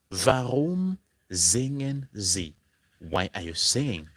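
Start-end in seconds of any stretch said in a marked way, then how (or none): 0.1-2.5 slow model question. Shown as a demonstration. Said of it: "pattern of question, word, verb".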